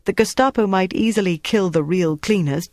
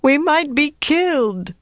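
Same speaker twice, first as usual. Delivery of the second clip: frightened moaning; 11 semitones higher than usual. A narrator's reading. Antoinette to Flick, dreading the Gestapo: high slow moaning. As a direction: moan it out in dread of the torture. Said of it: "Antoinette moaned".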